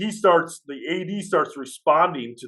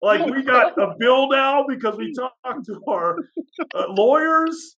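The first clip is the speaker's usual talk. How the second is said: high-pitched, drawn-out protest; exasperated and high, stretching the words; exasperated retelling; 12 semitones higher, 3 dB louder, slower than usual. Retold or imitated. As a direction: replay an incredulous protest to a prankster, voice way up. Acted exasperated reply to prankster.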